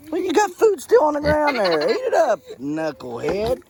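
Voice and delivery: mock high voice scolding a hungry pig; high teasing voice. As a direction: tease the pig in a silly high voice.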